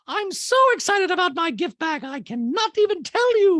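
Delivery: very high, loud mock gushing; Dorit impersonation. Host mocks housewife thrilled by gift bag, loud high gushing. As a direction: mimic overblown excitement way up high. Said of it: "Dorit goes".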